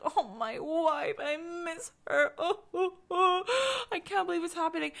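mock sobbing widower. Mocking the husband's fake grief with a high wailing sob. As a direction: ham up a grieving husband's sobs. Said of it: "it's like".

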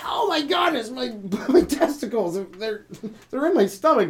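high, shrill panic over his testicles; man ordering a daiquiri, high panicked cry. Acted. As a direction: shriek in mock panic. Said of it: "As a man, you're like".

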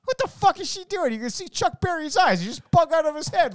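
loud, high incredulous rant. Retold or imitated. Comic ranting about [name] screaming, loud incredulous. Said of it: "that [name] thing".

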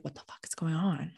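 hushed shocked whisper. Retold or imitated. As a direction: breathe it out, barely voiced. Shocked kids ask mom.

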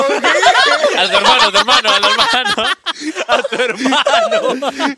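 high, slow, laughing echo of the question; laughing mockery; mocking laughing echo. Real, not acted. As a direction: drag it out high while laughing. Mocking friend's odd question.

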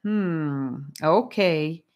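usual speaking voice

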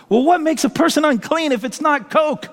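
high, urgent imitated question; baffled. Friend pressing preacher about the verse, baffled. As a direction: act out a friend's baffled demand.

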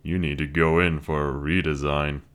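lightly voiced wry young man; dry young man. Luke mocks robot.

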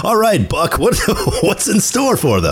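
high, showy announcer voice; game show host voice. Shown as a demonstration. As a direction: toss it over like a quiz host. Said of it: "game show host announcer voice".

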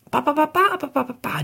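high-pitched nonsense babble, "ba ba ba"; excited chattering. A narrator's reading. Narrator mimics two children chattering through the car ride, excitedly. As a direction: imitate children jabbering away. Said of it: "Die sind ganz aufgeregt und labern".

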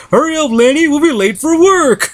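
high, singsong angel voice; Carl. Retold or imitated. Retelling Lenny's vision of heaven, high imitated voice. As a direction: pitch it way up, imitating a cartoon angel. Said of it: "all the angels are Carl".

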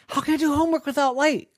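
high, fast whiny objection; squeaky indignant little boy; protesting kid. Acted. Smelly protests homework.

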